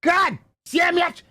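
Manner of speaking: high, slow mock outburst; hammed-up fury; imitating angry caller. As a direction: play the enraged caller, dragging it out. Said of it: "It was".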